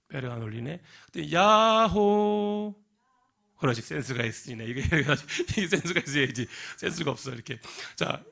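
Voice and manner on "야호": high, slow, louder echo call; calling yaho again. Acted. Girl on the mountaintop calling again, slow and high. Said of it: "산 꼭대기 올라갔어요".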